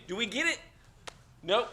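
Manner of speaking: usual speaking voice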